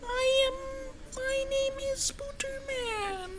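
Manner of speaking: very high, slow robotic squeal; high monotone cartoon voice; Spooderman. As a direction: stretch every word out high like a squeaky robot. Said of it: "You remember how he sounds like?".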